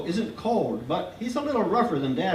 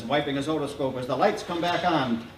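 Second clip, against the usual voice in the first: ordinary delivery, nothing marked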